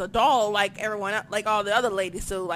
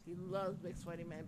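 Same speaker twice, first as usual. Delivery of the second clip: hushed aside; 5 semitones lower, 16 dB quieter than usual. Acted. Mocking Vince's tastes.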